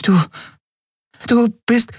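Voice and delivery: high, broken-off startled gasp of words; stammering in shock. A narrator's reading. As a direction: caught completely off guard. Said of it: "zeigte verdattert". (verdattert stammelnd, hoch)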